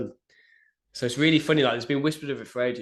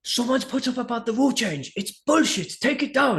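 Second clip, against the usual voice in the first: very high-pitched mock rant, louder; Gaston going mad; 13 semitones higher, 5 dB louder than usual. Official orders coach to remove the post, acted high-pitched outrage. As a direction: imitate an irate official demanding action, voice shooting up high. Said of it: "but he was going mad".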